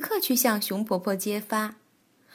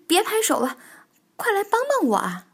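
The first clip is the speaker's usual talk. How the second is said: high, urgent call for help; crying out for help; 9 semitones higher, 3 dB louder, faster than usual. Acted. Bengbeng begs a clapping friend for help, crying out. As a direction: cry out, pitched up high. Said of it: "蹦蹦大叫".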